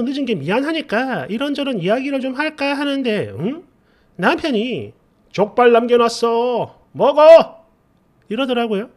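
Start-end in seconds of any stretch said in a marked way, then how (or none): 5.3-7.7 slow then a sudden loud bark on 먹어; tossing it off, then barking the last word; husband's curt order. Retold or imitated. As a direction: mimic a husband snapping at his wife to eat. Husband offhandedly pointing to leftovers.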